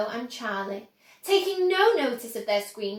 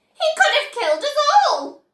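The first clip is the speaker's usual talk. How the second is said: put-on a very high, loud, fast old woman's outcry; outraged shriek; 14 semitones higher, 9 dB louder, faster than usual. A narrator's reading. Grandma Josephine accusing Wonka, outraged high shriek. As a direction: spit it out in fury.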